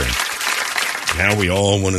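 ordinary talk

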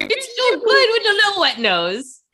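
put-on very high cooing baby talk; pet talk voice. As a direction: gush over a puppy. Continues pet talk bit.

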